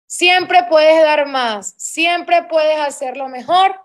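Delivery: loud, slow, every word pushed out; rally the team with a big slow call; rousing exhortation. Real, not acted. Coach urging her sales team, loud and slow.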